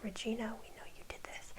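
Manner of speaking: hushed, breathy accusing whisper; whispered accusation. Retold or imitated. Townsfolk tell Regina they know, whispered. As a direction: whisper it like a crowd that knows.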